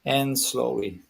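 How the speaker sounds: soothing induction, sinking gradually; guided hypnosis. Real, not acted. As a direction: ease them into relaxation with measured pauses. Starts leading a hypnosis relaxation.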